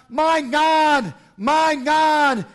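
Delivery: desperate loud cry to the Father. Acted. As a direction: cry up to heaven in agony. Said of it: "cried out".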